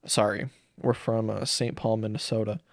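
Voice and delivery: ordinary talk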